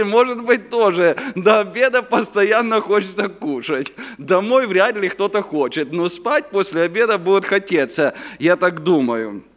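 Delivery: normal talking voice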